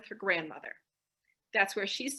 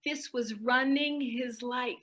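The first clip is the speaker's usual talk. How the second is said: ordinary talk, nothing marked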